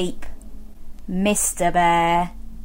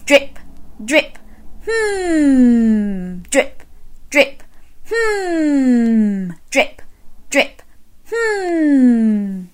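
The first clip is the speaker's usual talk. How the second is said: drip and hum; 3 semitones higher, much slower than usual. Shown as a demonstration. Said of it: "can you do those two things".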